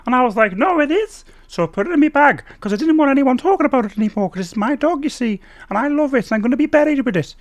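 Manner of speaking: very high, loud comedian's storytelling voice; Sarah Millican impression. Mock comedian telling a brick dog joke, high impression. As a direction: tell it as a high-pitched stand-up parody. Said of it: "Her jokes are like that".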